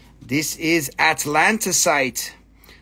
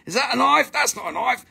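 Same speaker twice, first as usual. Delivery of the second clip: higher-pitched mock Aussie movie line; bad Australian accent; 6 semitones higher, faster than usual. Seller joking about a knife, bad Australian accent. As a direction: quote it in badly faked Australian. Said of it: "that's all my really bad Australian shit".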